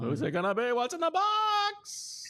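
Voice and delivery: climbing to a very high, drawn-out cry; hyped reveal. Pretend. Host building suspense before the pick, hyped. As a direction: tease the reveal, stretching the last word up high.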